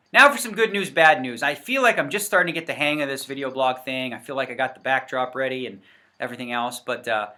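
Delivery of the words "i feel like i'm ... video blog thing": ordinary talk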